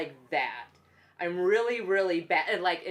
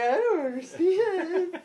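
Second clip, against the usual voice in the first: high, drawn-out whine, half laughing; hammed-up clueless cry; mock helpless wail; 11 semitones higher, 4 dB louder, slower than usual. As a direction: play up being hopelessly lost. Acts out helplessness with insurance.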